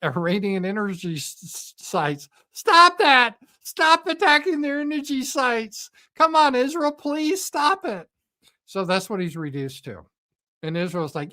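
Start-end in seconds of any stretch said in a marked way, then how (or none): 2.5-8.1 much higher, whiny, pleading; mock Trump pleading. Trump begging Israel to stop, whiny mock plea. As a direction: beg like a helpless little man.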